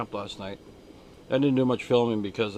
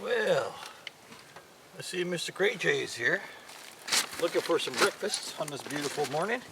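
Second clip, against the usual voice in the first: talking to a bird; 4 semitones higher, 8 dB quieter than usual. Real, not acted. Talking to a grey jay.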